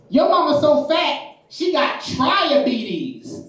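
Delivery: high, drawn-out schoolyard roast; little girl. Retold or imitated. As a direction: imitate a kid landing a joke. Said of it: "There's this little girl".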